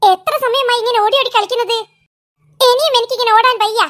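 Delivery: ordinary talk, nothing marked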